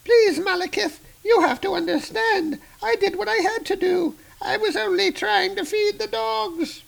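mother begging her son for mercy; pleading old crone. Acted. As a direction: grovel for your life.

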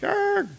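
high hammed-up "arr"; a silly monster shout; mock troll roar. Troll growl.

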